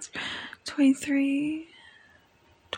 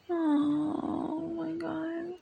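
slow, soft moaned words; long hushed moan of pleasure; drawn-out moan; 3 semitones higher, 5 dB quieter, much slower than usual. Acted. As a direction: moan it softly, stretching the oh. Moaning with pleasure.

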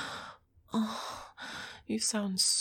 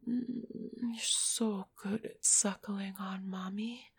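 ordinary delivery

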